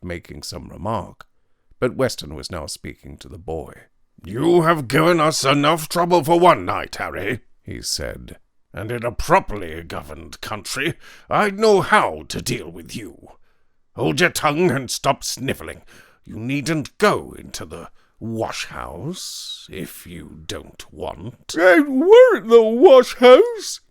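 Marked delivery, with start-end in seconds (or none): 4.2-21.5 stern scolding. A narrator's reading. Weston scolds the boy. 21.5-23.8 high, loud, slow wailing sob; sobbing. A narrator's reading. Half-wit sobs to Weston, loud and wailing. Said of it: "sobbed the half-wit".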